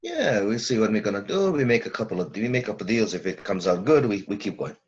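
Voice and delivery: put-on lightly done Brooklyn accent as a client; a hint of a Brooklyn business owner. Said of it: "my Brooklyn accent needs work".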